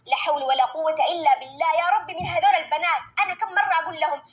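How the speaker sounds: put-on a fed-up mum scolding the girls; mother complaining nobody listens; exasperated mother. Performer voices the scolding mother.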